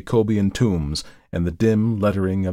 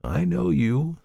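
high-pitched, gently severe; kind severity; 8 semitones higher than usual. A narrator's reading. Visitor answers Markheim's denial, with kind severity. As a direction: chide kindly, without force. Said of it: "a sort of kind severity rather than firmness".

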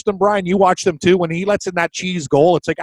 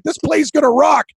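excited; 9 semitones higher than usual. Retold or imitated. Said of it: "I'm like".

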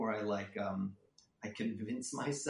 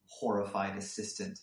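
ordinary talk, nothing marked

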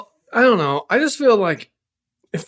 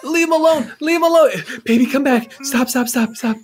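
high loud desperate pleading; desperate pleading shout; 7 semitones higher, 3 dB louder than usual. Acted. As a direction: plead loudly for him. Mother pleads as son is hurt.

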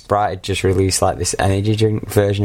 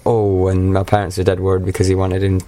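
ordinary talk

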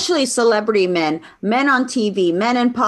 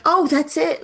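higher, lightly condescending imitation; mock patronizing; 5 semitones higher than usual. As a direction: patronize the listener a little. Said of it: "or it's patronizing".

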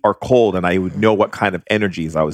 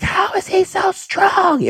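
very high, slow screaming cry; retold spirit scream; slower than usual. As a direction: wail it like a spirit in agony. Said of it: "I could hear them screaming".

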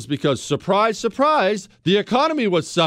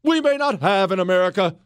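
panic monger; 10 semitones higher than usual. Retold or imitated. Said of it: "They sent every panic monger".

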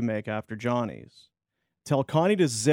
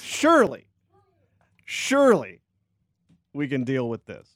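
imitating the coach. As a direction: stretch out "Surely" like the coach. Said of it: "Surely to".

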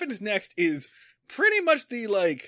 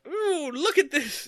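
slow, higher sing-song "ooh"; higher, slow mock voice of a show-off; 6 semitones higher, slower than usual. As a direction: show off like a goofy kid. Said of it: "Oh, like".

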